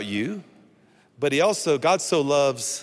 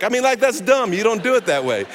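higher-pitched mock disbelief; comic incredulity; 5 semitones higher, 5 dB louder than usual. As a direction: play up the absurdity for laughs. Comic rant about absurd doctor.

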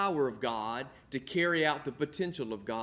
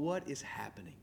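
whispered, much softer anxious question; whispered worry; 3 semitones lower, 11 dB quieter than usual. Retold or imitated. Pastor anxiously wondering to himself on stage, whispered. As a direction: hush it like a nervous private thought. Retold anxious thought while preaching.

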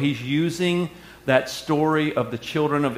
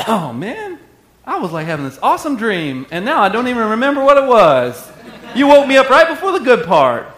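mock just-woken grumble; 8 semitones higher, 8 dB louder than usual. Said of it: "He was asleep going".

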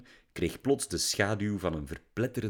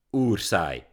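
teasing; 5 dB louder than usual. A narrator's reading. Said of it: "plaagde Lily".

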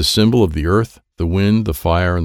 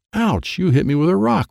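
higher-pitched narrated cry of pain; pained groan; 9 semitones higher than usual. A narrator's reading. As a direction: groan out a mild ouch. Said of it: "Wyatt groaned".

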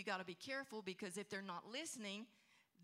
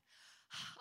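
demo sigh. Shown as a demonstration. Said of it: "they'll probably do this".

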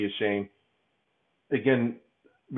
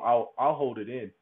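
usual speaking voice

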